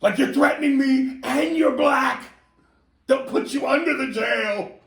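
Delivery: high, loud frantic yelling; panicked shouting. Retold or imitated. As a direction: shout it out, feeling threatened. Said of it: "Jack gets panicked and goes".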